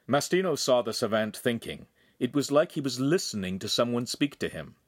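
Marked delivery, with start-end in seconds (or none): none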